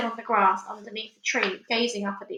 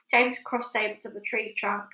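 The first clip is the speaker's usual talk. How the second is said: ordinary delivery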